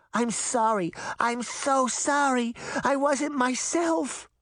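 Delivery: high, slow tearful apology; sorry through her tears; a little girl apologizing while crying. A narrator's reading. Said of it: "through streaming tears".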